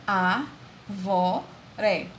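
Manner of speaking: "a, vó, ré" in separate syllables. Shown as a demonstration. Teacher showing the class the word's syllables, syllable by syllable. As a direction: split "a, vó, ré" with a pause after each. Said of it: "e as sílabas".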